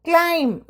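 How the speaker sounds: ക്ലൈം said as klaim, no b at the end; ക്ലൈം with silent b, model pronunciation; silent b climb. Shown as a demonstration. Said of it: "ആ ബി silent ആയിട്ടാണ് എപ്പോഴും".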